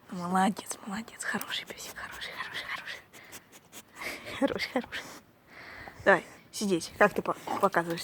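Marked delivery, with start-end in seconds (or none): 0.1-5.0 whispering. Real, not acted. Whispered praise to dog.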